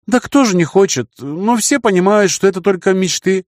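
put-on high, breezy young woman; girl character voice. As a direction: voice her light and high. Girl's candid reply.

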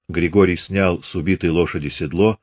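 ordinary delivery, nothing marked

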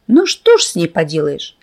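ordinary delivery, nothing marked

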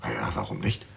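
barely whispered, half-shrugging answer; hushed reply. Acted. Suspect whispers his answer to the inspector's question. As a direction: let it slip out in a whisper.

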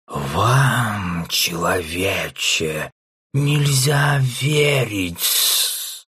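very slow, hissing snake; hissing serpent. Acted. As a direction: drag each word out like a serpent. The serpent refuses the offer.

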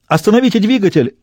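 high, alarmed plea; panicked cry. Acted. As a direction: cry out for it to stop. Panicked cry to stop the engine.

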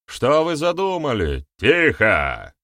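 demand, then bark for silence; barked order. A narrator's reading. Boryata silences the noisy gathering, barking. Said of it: "рявкнул Борята".

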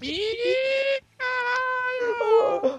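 high, stretched-out shocked shout; long drawn shout. Real, not acted. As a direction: drag out the shout in disbelief. Shocked outburst at drunk friend.

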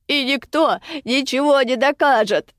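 high, defiant taunt full of malice; contemptuous, spiteful. A narrator's reading. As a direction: spit it out with scorn. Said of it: "И сколько же презрения, сколько злобы было".

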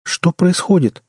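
slow, high-pitched baffled question; stunned bewilderment. A narrator's reading. Woman coming out of her stupor asks, stunned. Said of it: "вышла из ступора Энн".

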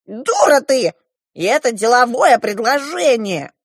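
high, loud, scolding dwarf; shouting dwarf. A narrator's reading. The dwarf insults the girl over his offer, shouting. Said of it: "закричал на меня карлик".

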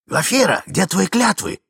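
loud, high accusing cry; outcry. Acted. Young man confronting Glafira, loud and high. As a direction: call her out on her broken oaths. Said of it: "вскричал молодой человек".

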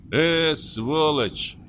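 slow, drawn-out curse; grumbling curse. A narrator's reading. Hunter turns to the door, grumbling. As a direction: draw out the insult in disgust. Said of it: "проворчал охотник".

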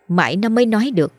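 plain reading voice

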